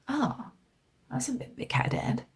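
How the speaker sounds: hushed sneering aside; mocking whisper. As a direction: whisper it like a snide critic. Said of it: "people think like".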